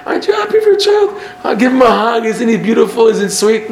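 high-pitched, eager coaxing; gushing encouragement. Acted. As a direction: gush over an adorable child. Coaxing a fussy parent to appreciate child.

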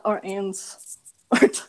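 put-on French accent, dropping the h in "hands"; slow French-accented "ands" for "hands". Showing a friend how the French would say it, French accent. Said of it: "you don't say hands, right? You would just be like".

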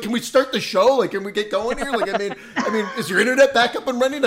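mock impatient friend asking; impatient whiny quote. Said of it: "J P is like".

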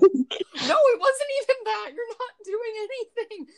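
high, loud mock protest; mock outrage.

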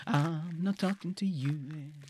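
slow, soft stubborn mutter; quiet, trailing off. Acted. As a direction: say it under your breath, slowly. Repeated refusal, fading away.

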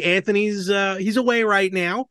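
usual speaking voice

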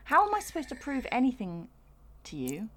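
high-pitched, incredulous complaint; exasperated protest. Acted. Mock protest at the facts given.

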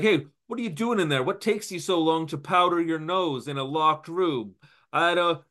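high, quick and needling at the door; a gangster pestering through a locked door; pushy gangster. Acted. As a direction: hammer on the door with questions.

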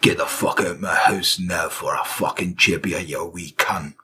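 low-pitched mock Scottish rant; Scottish husband. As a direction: play a raging husband in broad Scots. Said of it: "he was thrown out by the husband".

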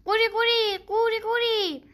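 high, loud croaking call; high frog croak; happy frog croak. A narrator's reading. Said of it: "با خوشحالی قبول کرد و گفت".

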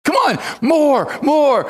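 high, slow, drawn-out mock cheer; taunting egging on. Elijah egging on Baal's prophets, high slow mock cheer. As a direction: egg them on like a gleeful heckler. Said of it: "Elijah's just taunting them".

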